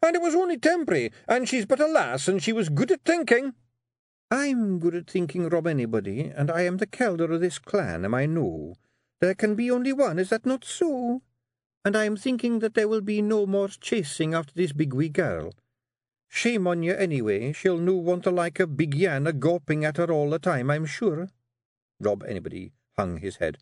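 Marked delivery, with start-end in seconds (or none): 0.0-3.5 very high, fast Scottish babble; desperate excuses. Acted. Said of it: "desperately looking around for a way of escape". 4.3-21.3 high, measured scolding wife; stern Scottish Kelda. Acted. Kelda forbids Rob chasing the girl, sternly.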